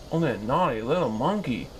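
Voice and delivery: ordinary delivery, nothing marked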